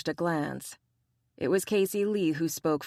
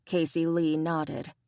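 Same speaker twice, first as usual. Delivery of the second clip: ordinary delivery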